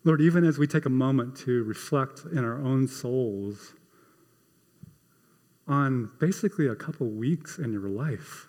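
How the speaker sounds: soft, low, unhurried prayer voice; closing prayer. Acted. Pastor praying over the congregation at the end of the sermon, soft and reflective. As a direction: speak gently to God, reflective.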